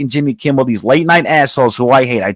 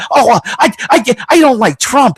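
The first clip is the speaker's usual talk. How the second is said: mock foreigner; 8 semitones higher than usual.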